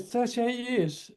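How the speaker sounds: high, hushed, drawn-out disbelief; incredulous echo. Retold or imitated. Young welder repeating the old fellow's answer, acted hushed disbelief. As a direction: echo it slowly as an astonished question.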